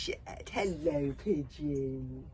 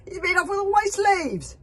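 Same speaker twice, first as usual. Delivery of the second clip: high furious yell, much louder; raging shout; 13 semitones higher, 14 dB louder than usual. Acted. Villain rages on deck about escaped slaves. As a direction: bellow your fury at the lost slaves.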